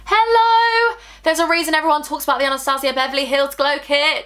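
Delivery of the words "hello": high, drawn-out delighted hello; excited hello. Real, not acted. Vlogger greeting her new highlight, high excited hello.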